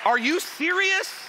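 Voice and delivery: high, slow incredulous question; mock outrage. Pastor appalled at pineapple on pizza, mock outrage.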